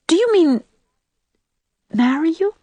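high, faltering disbelief, broken by a pause; feeble query. A narrator's reading. Anne checks that Sam really proposes, feebly. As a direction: ask it feebly, pitched right up. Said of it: "queried poor Anne feebly".

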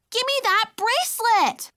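put-on high, loud little-girl demand; a tiny girl lunging in rage; furious cry. A narrator's reading. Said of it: "she cried".

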